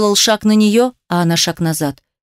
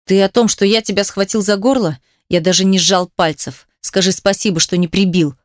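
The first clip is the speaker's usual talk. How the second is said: ordinary delivery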